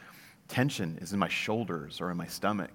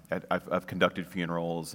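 ordinary delivery

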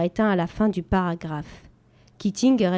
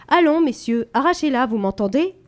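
loud, high, urging; urging teacher; 5 semitones higher, 4 dB louder than usual. A narrator's reading. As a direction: push the boys to act. Teacher urges boys.

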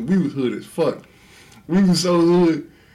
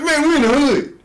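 mock lady; 10 semitones higher, 4 dB louder than usual. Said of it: "It's like".

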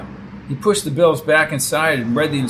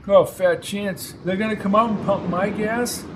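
put-on old man voice; 6 semitones higher than usual. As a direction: scoff like a weary old-timer. Old driver voiced in story.

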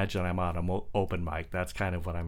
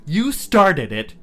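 slow, loud, angry retort; drawn-out, loud accusation; growling; 9 semitones higher, 11 dB louder, slower than usual. Acted. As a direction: hurl it back at the car. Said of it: "and growled".

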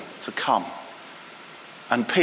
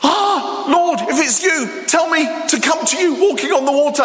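much higher, gasped-in excited outburst; high-pitched, impetuous Peter plea; impulsive blurting; 9 semitones higher than usual. Retold or imitated. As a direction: blurt it eagerly before thinking. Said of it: "I just wonder if it was like".

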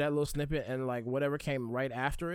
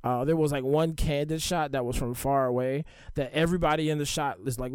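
ordinary delivery

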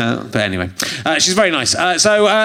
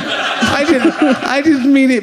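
hammed-up begging, higher; pleading for TV; 4 semitones higher than usual. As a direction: beg to be on telly. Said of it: "Massive twat".

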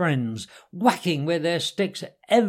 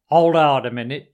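loud, firm check; restraining command; 8 dB louder than usual. A narrator's reading. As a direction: hold back an eager friend. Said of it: "restraining him with difficulty".

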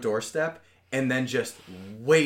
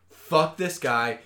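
slow, louder, disgusted; disgusted outburst; 5 semitones higher, 6 dB louder, slower than usual. Real, not acted. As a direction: spit it out in disgust. Ranting about a pathetic movie character.

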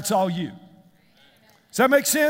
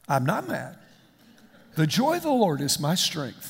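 low, soft, overly composed; mock calm denial; 6 semitones lower, 4 dB quieter than usual. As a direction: play the serene pastor denying any anger. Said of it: "in the most wonderful, calm voice".

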